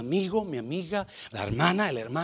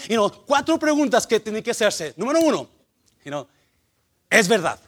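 loud, higher-pitched pulpit delivery; forceful preaching; 4 semitones higher, 11 dB louder than usual. Real, not acted. Preacher drills four questions into the church, forceful preaching. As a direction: drive each question home at full force.

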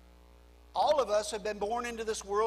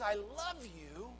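tender God voice; 10 dB quieter than usual. Acted. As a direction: hush it down and speak like God consoling a sinner. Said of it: "God says".

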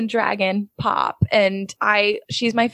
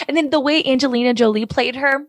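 ordinary delivery

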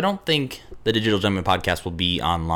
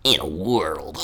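Doug Funnie voice; 5 semitones lower, slower than usual. Pretend. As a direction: lower cartoon boy trailer voice. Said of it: "You sound like Doug Funny".

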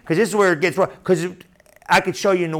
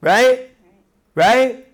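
usual speaking voice

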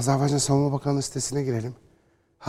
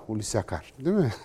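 ordinary talk, nothing marked

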